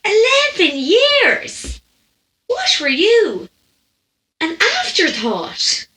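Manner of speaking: high, drawn-out astonishment; incredulous. Acted. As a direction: imitate an aghast woman, stretching each question. English woman aghast at age gap.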